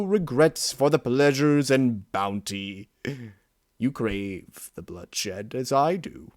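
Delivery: a dark creature coaxing its host toward bloodlust; tempting inner beast. Acted. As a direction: savour each word like a hungry monster. Inner beast taunts narrator.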